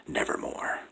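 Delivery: soft unvoiced croak; hushed croaking raven. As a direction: croak the word in a whisper. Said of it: "meant in croaking".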